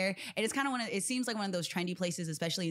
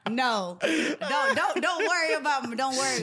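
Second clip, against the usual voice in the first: excited protest; 5 semitones higher, 4 dB louder than usual. Real, not acted. Protesting teasing about being single.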